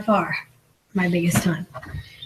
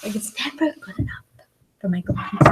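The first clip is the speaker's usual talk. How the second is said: whisper; 3 dB quieter than usual. Real, not acted. Muttering complaint about husband.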